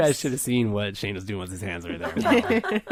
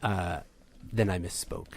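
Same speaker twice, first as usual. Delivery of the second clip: low and soft, faintly sultry; lightly sultry; 6 semitones lower, 6 dB quieter than usual. Acted. Android backtracking on the price, lightly sultry. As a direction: drop down low with a hint of sultriness. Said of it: "I'll do a sultry voice".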